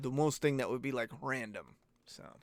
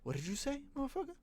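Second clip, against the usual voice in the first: angry Twitter mob; 11 semitones higher, 8 dB quieter, faster than usual. Retold or imitated. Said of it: "They'd be knocking on my door".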